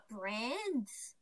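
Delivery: whispered. Acted. Whispered after the bell.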